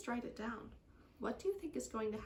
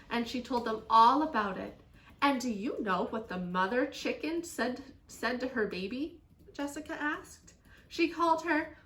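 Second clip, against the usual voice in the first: put-on lively, expressive children's story reading; story read-aloud; 8 dB louder than usual. A narrator's reading. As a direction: read to children with strong emphasis.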